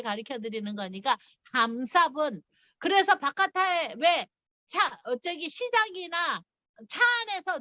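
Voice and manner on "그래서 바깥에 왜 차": ordinary delivery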